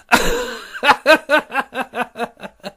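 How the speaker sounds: high teasing cackle; mocking laugh. Goldatin laughs at Ratakhanov as he leaves, mocking. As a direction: laugh to tease him.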